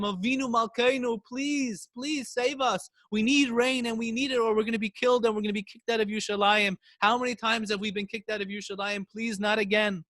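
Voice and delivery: high, pleading prayer; desperate plea for rain; crying out. A narrator's reading. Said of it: "they cried out to Hashem".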